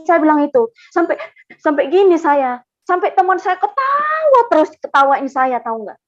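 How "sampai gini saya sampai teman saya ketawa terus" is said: plain reading voice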